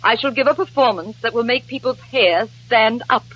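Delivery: wounded theatrical vow. Acted. Nora vows to perform despite discouragement, wounded grandeur. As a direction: declaim bravely, fading into heartbreak.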